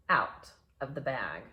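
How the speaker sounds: ordinary delivery, nothing marked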